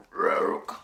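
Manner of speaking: loud, rough animal croak; old lady croaking. Acted. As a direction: croak it out like a creature. Old lady turned animal sound.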